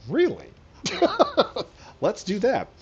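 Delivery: high, loud excited squeal of discovery; bursting with glee at a new trick; delighted disbelief. Retold or imitated. Gamer thrilled to learn he can throw goblins, excited squeal.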